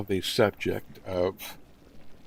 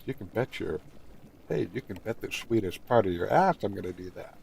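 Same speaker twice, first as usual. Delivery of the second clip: ordinary talk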